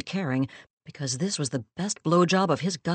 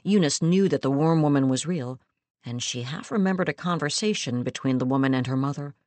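usual speaking voice

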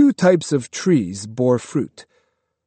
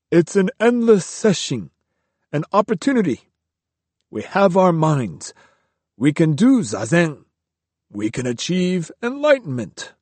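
higher, calm, devout monk voice; serene devout monk; 6 semitones higher than usual. A narrator's reading. Riku calmly preaching to Kyo at night. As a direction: preach contentment to a grumbling friend. Riku's voice, earnest.

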